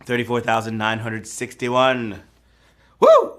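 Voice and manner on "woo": high, loud celebratory whoop; a big joyful woo; excited whoop. Real, not acted. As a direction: whoop for the players. Host cheering a correct answer.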